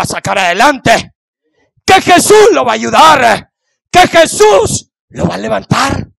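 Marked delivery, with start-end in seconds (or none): none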